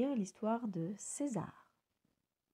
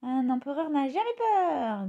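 louder, slow and proud; defiant little bird; 5 semitones higher, 5 dB louder than usual. A narrator's reading. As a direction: declare it slowly with pride. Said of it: "dit César".

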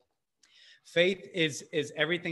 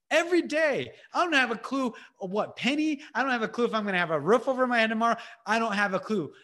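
rising insistent refrain; 6 semitones higher, faster than usual. Real, not acted. Preacher building an insistent refrain.

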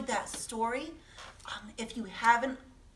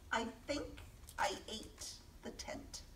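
sheepish whisper; 10 dB quieter than usual. Acted. Monster's sheepish confession.

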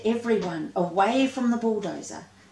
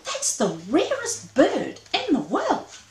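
amazed; 7 semitones higher, 3 dB louder than usual. Acted. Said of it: "in absolute amazement".

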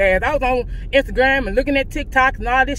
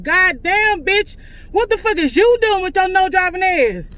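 higher, raised angry yell at a bad driver; angry outburst; 7 semitones higher than usual. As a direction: snap at the driver who almost hit her. Cursing at a reckless driver.

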